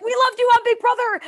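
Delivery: high, loud gushing fan voice; excited fans. Retold or imitated. Fans at Disney gushing over her, excited and high. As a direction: squeal it like a starstruck fan.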